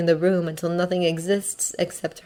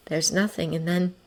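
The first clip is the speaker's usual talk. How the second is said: ordinary delivery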